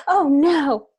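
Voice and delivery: high, loud gasping dismay; gasp, then cry out in playful horror; alarmed gasp. Pretend. Player reacts to hitting the dog.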